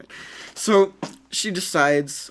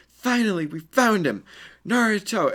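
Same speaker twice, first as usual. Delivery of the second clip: put-on high, louder girlish cry; excited girls; 9 semitones higher, 4 dB louder than usual. Girls finding Naruto at the ramen shop, high excited cry. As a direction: shout it out like eager fangirls. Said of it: "speaking in sync".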